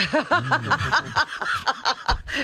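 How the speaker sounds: high burst of laughter; big laugh. Real, not acted. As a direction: break into a squealing laugh. Laughing at own joke.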